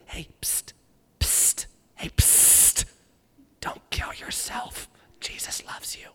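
hushed secret whisper. Pretend. Preacher imagining escaping prison, whispering. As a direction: whisper it like sneaking past guards. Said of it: "I would probably be like".

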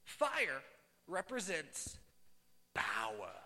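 "Fire represents power" word by word with long pauses; emphatic key point. Real, not acted. Preacher teaching what fire stands for, "Fire represents power" emphatic and spaced out.

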